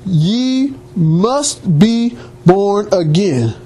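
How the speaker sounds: "Ye must be born again", each word drawn out slowly and separately; weighty, very slow "Ye... must... be... born... again"; emphatic quoted command. Retold or imitated. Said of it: "He put an emphasis on that".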